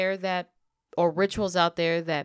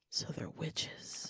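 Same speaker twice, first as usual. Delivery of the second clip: slow hushed whisper; spooky whisper; 15 dB quieter, much slower than usual. Pretend. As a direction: breathe it out slowly like a secret. Spooky whisper about witch cats.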